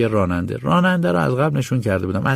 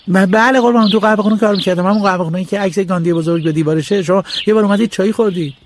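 much higher, eager and servile; stammer, then rush to please; boy appealing to the village landlord; stuttering humble boy; 10 semitones higher than usual. Acted.

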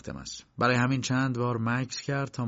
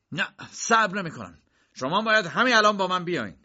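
high-pitched, insistent outburst; agitated, much higher demand; raised voice; 8 semitones higher than usual. A narrator's reading. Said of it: "دختری که صدای بلند کی".

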